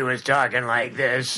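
imitating an aging star's smoky rasp; smoky whiskey voice. Interviewer imitating the actress later in life, smoky whiskey voice. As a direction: put on a smoky, whiskey-soaked voice. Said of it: "the smoky whiskey voice".

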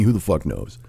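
ordinary delivery

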